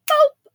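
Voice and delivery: a loud high squeaky boop. Pretend. Sound effect as the name lands on the deed.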